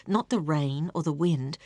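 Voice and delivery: plain reading voice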